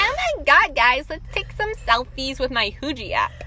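put-on high excited valley girl voice. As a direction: gush like a trendy teen girl. Mocking Visco girl voice.

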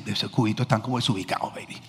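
soft, low murmured remark; quoted aside. Retold or imitated. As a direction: say it under your breath, low. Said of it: "yo decía". (comentario en voz baja y grave)

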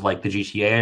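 ordinary delivery

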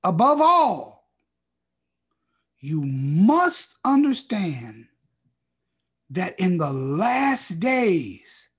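slow, loud, each phrase weighed; slow emphatic reading. Shown as a demonstration. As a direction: drag out every word for weight. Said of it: "slow emphatic scripture read".

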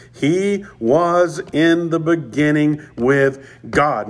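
slow, stressed scripture line; emphatic reading. Shown as a demonstration. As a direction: lean on each word of He was in the beginning with God. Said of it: "an emphatic aspect to this".

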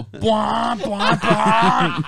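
motorbike revving. Retold or imitated. Imitating bikes revving up hill.

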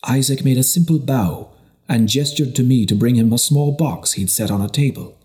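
ordinary talk

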